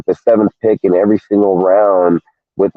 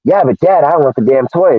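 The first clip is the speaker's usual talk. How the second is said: put-on high whining little-girl voice; higher, pleading kid voice; whiny daughter; 7 semitones higher than usual. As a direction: imitate a five-year-old begging for a toy. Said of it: "And she says".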